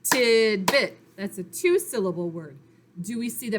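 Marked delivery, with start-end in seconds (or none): 0.0-1.0 TIDBIT loud, in two equally stressed beats; clapped-out syllables. Shown as a demonstration. Teacher claps out the word with pupils, syllable by syllable. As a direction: punch out TID and BIT as separate syllables. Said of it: "Let's clap out tidbit".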